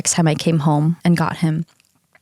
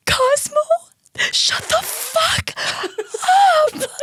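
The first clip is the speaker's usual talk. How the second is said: mock scream; much slower than usual. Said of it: "screaming at him".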